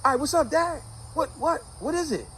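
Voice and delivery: put-on high-pitched kid answering back; Michael. Son answers his father's call, high-pitched reply. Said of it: "Michael was like".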